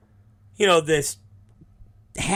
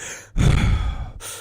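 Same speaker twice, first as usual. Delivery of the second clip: imitated long, heavy exhale; heavy breathing. Wrestler having her head wrapped, heavy breathing. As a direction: blow out breath hard, as if coping with pain.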